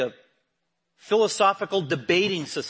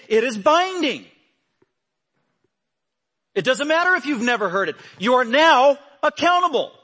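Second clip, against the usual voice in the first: much higher and louder, decreeing; fiery preaching; 10 semitones higher, 7 dB louder than usual. Acted. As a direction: thunder it as a binding decree. Preaching builds to full force.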